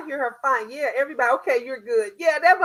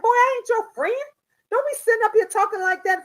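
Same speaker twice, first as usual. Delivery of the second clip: high, loud sharp scold; scolding son; 8 semitones higher, 4 dB louder, faster than usual. Retold or imitated. Mother scolding son who talks to her like a friend. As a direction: put him in his place. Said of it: "I'm like".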